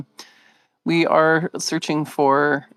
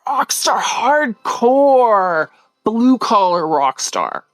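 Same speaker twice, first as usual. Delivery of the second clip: hyped praise; 8 semitones higher, 4 dB louder, much slower than usual. Real, not acted. Enthusiastic praise.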